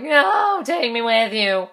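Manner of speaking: hammed-up plea; playful pleading. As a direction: beg jokingly not to be left behind. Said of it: "messing around".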